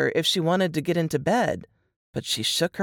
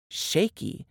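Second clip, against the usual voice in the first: ordinary delivery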